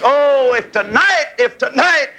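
high ringing revival appeal; fervent preacher's plea; pleading cry. Real, not acted. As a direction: lift the voice and plead with the crowd. Preacher's revival appeal at full force.

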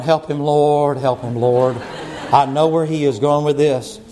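low, mock-prayerful plea; deep-pitched comic muttered prayer; imitating Miss Denise. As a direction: play a worried churchgoer praying under it all. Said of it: "Miss Denise is going".

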